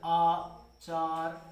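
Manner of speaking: चार with the आ drawn out slowly; long aa. Shown as a demonstration. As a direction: pull the vowel of चार long. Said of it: "वो थोड़ा खिंचा". (चार में आ को खींचकर बोलें)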